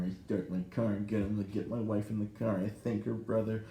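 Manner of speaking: ordinary talk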